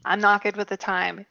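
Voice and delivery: usual speaking voice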